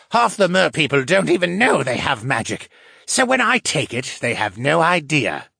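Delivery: snorting scorn. A narrator's reading. Captive merman scorns his own people, snorting. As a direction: scoff at the others, voice lifted. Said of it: "the fisherman snorted".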